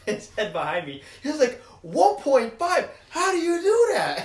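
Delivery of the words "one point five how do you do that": high, slow mock incredulity; half-laughing imitation of an angry friend; retold anger. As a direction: quote him aghast, pitch climbing.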